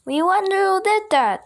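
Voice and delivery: high loud crowd shout; fans shouting. A narrator's reading. Fans in the bleachers shouting, high loud. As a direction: shout it together. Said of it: "shouted the fans".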